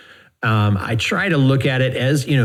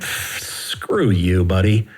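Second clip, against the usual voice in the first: retold dismissal; 4 semitones lower, much slower than usual. Retells rebuffing a lowball offer.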